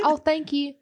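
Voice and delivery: high, polite Southern thank-you. Retold or imitated. Explaining politeness back home, high country accent. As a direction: lift it sweetly with a country accent. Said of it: "like, more country".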